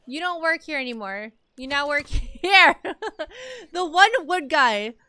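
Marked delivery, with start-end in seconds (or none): 1.6-4.9 slow words broken by laughter; laughing. Real, not acted. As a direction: giggle through the words. Laughs at game worker.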